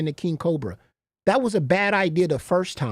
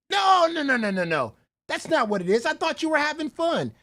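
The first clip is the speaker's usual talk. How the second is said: mock protesting; 7 semitones higher than usual. Acts out their protesting reply.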